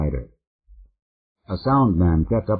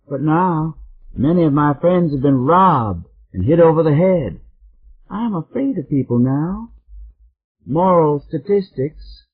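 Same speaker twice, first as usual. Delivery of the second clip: put-on much higher sweet older Southern lady, worried; high sugary voice turned fearful; sweet caller; 9 semitones higher, 3 dB louder than usual.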